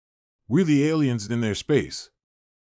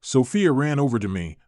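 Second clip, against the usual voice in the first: ordinary delivery, nothing marked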